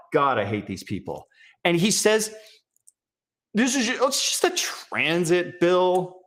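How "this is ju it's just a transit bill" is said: slow, drawn-out mock-innocent tone, pitched higher at the start; mocking casual politician. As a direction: parody his fake-casual reassurance. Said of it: "concern troll face".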